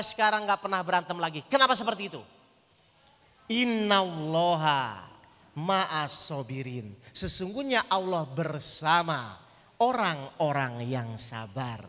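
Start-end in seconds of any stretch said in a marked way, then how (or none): 3.5-11.8 very slow, low, measured recitation; solemn scripture recitation. Real, not acted. Preacher answers why with a verse on patience, solemn recitation. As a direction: draw each phrase out, low and softened, with reverence.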